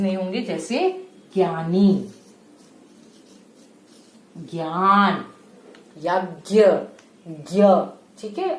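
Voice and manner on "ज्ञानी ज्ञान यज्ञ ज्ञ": ज्ञानी and ज्ञान said slowly with a gy sound; new pronunciation. Shown as a demonstration. Teacher giving children the modern sound to learn. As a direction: model ज्ञ as half g plus y, slowly. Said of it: "आधा ग और य जो अभी नया".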